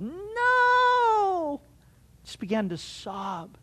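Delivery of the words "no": a high, drawn-out wailing no; imitated wail. Pastor imitates his wife's grief at the news, wailing. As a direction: wail the no like a grieving wife. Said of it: "She wailed".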